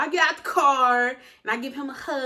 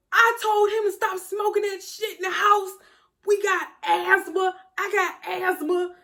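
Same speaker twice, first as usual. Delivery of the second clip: scolding mom; 7 semitones higher than usual. Retold or imitated. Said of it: "she goes".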